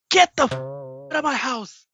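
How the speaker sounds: high, slow mock-furious order; imitated angry mom. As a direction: throw them out like the livid mother. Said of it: "And then she goes".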